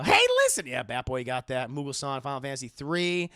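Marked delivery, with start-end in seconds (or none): 0.0-0.7 high, loud helper call; Navi. Retold or imitated. Streamer mimicking a game helper for chat, high loud call. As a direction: cry it out high to get attention. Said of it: "from Navi".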